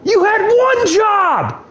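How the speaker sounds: high, slow, yelled scolding; exasperated yell. Real, not acted. Gamer yelling at a player who failed, slow and high. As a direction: drag it out at the screen, fed up.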